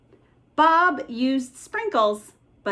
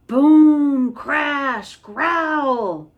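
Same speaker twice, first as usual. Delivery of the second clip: a much louder booming shout; 4 semitones higher, 7 dB louder, much slower than usual. Acted. Monster noise returns, louder boom. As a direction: blast the word out.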